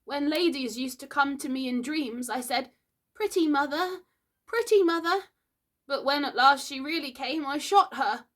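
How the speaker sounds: put-on high, wistful boyish voice; reflective. A narrator's reading. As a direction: recall a cherished dream with regret.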